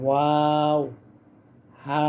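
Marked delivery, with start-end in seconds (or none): 0.0-0.8 ওয়াও with rounded lips, drawn out slowly; rounded and held long. Shown as a demonstration. Teacher showing viewers the rounded mouth, long and held. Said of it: "মুখ গোল হবে".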